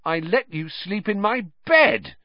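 high-pitched, appalled disbelief; appalled high outburst; revolted. A narrator's reading. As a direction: say it aghast, pitched right up. Revulsion at the rat he housed.

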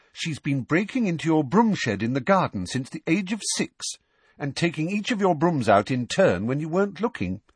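put-on high-pitched know-all girl putting the boys straight; Hermione. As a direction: lift the voice high, matter-of-fact correction. Hermione voiced in narration.

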